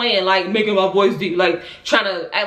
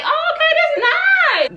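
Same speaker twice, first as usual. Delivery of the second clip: pitched way up, slow, laughing; delighted high squeal; 4 dB louder, slower than usual. Retold or imitated. Tenant upgraded to a bigger apartment, squealing with delight. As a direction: coo it out, pleasantly surprised. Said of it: "There, I was like".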